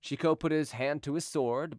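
usual speaking voice

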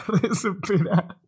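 slow words through laughter; amused, laughing at the memory. Real, not acted. Storyteller cracking up over his friend, laughing.

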